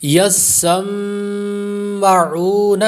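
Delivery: یسمعون recited very slowly with ghunna on meem and a long waw; tajweed recitation. Shown as a demonstration. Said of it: "میم مشدد کا غنہ اور واو مدہ".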